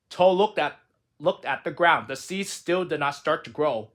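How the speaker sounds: ordinary delivery, nothing marked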